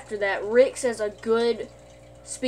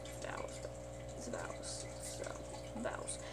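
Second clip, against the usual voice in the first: barely voiced, muttering vowels to himself; whispered muttering; 21 dB quieter than usual. Shown as a demonstration. Vlogger mutters the word to himself, whispered. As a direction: hush it down to almost nothing.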